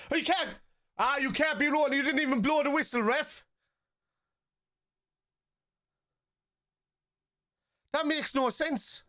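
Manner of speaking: high, strained protest at the referee; indignant yelling at ref. Real, not acted. Streamer yelling at the referee over a missed whistle, outraged. Furious at ref during match.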